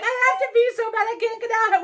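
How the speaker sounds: laughing plea. Retold or imitated. Telling her friend she must pee, laughing desperately. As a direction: squeal it out between laughs.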